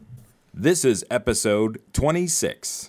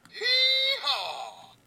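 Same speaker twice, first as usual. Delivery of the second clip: high braying hee-haw; donkey bray; 3 dB quieter than usual. Acted. Animal sound after laugh.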